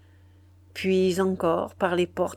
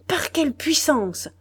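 higher, pressing cry of disbelief; shocked demand; 8 semitones higher than usual. A narrator's reading. The general demands how the intruder got in, in shocked disbelief. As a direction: demand it, still reeling. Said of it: "s'écria le général".

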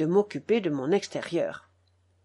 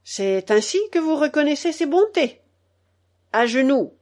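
raised, theatrical indignation; high, tragic scolding; tragic exclamation; 6 semitones higher, 5 dB louder than usual. A narrator's reading. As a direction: declaim the reproach, ending on a loud order. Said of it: "d'une voix tragique".

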